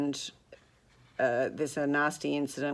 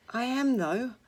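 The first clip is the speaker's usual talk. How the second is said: forced effort; 10 semitones higher, 4 dB louder than usual. A narrator's reading. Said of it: "with a visible effort".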